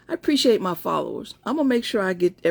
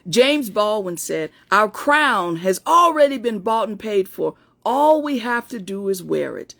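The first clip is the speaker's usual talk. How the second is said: fervent pep-talk reading; 3 semitones higher, 3 dB louder than usual. Acted. Reading a favorite pep talk to followers, increasingly fervent. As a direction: build from measured quote to higher, rousing declaration.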